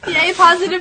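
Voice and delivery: loud, high, drawn-out cheer with laughter; gleeful cheering. Real, not acted. Co-host celebrating kind listener mail, gleeful cheering. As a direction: whoop it up, laughing.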